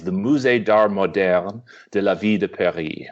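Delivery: Musée d'Art Moderne de la Ville de Paris said in careful French; the museum's full name said the French way; French pronunciation. Shown as a demonstration. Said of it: "So the full name in French".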